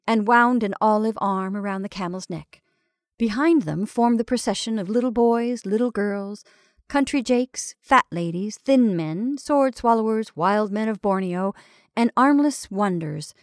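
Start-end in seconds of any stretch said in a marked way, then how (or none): none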